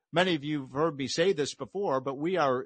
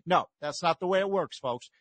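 usual speaking voice

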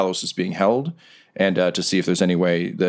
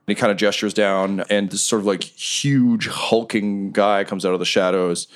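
usual speaking voice

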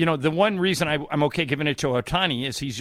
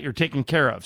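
ordinary talk

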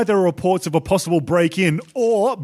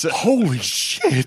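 stunned; 2 semitones lower, much slower than usual. Acted. Reporter stunned.

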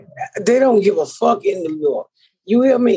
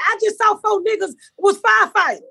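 high-pitched indignant comeback; mock exasperated; 9 semitones higher than usual. Shouting back at the bum, mock exasperation. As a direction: act out arguing back, voice pitched up. Retold argument about race.

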